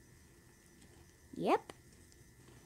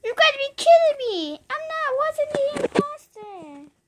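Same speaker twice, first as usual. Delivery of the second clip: outraged; 11 semitones higher than usual. Acted. Outrage at wrong vote.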